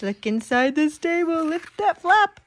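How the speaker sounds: ordinary delivery, nothing marked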